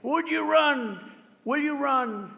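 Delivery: high, pushy repeated question, mock yell; mock screaming reporters. Reporters mockingly yelling at DeSantis, high and pushy. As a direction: hammer the question like shouting reporters. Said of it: "these maniacs are screaming".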